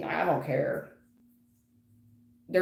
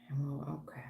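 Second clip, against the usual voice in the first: whisper; 6 semitones lower, 14 dB quieter, faster than usual. Real, not acted. Whispered aside while reading.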